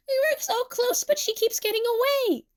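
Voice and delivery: high-pitched excited complaint; frustrated wail. A narrator's reading. Emu losing Nene in the chase, frustrated wail. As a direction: wail it high as she slips away.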